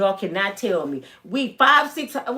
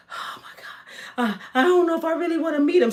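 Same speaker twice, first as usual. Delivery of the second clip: nervous whisper then rush; 5 semitones higher than usual. Retold or imitated. Nervous cousin voiced.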